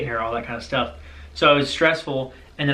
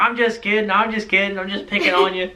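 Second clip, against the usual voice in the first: higher-pitched joking dad impression; Barry; 6 semitones higher than usual. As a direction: imitate a teasing father letting someone off the hook. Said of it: "He was laughing".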